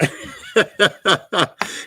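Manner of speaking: loud staccato chuckle; laughing. Real, not acted. Streamer amused at being called dumb, laughing. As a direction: laugh out in a quick string of ha-has. Laughs at imagined insult to himself.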